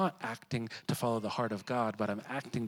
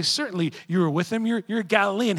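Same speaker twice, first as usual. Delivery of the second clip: louder, higher pointed accusation; accusing crowd; 8 semitones higher, 9 dB louder, faster than usual. Acted. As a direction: call him out in front of everyone. Bystanders accuse Peter.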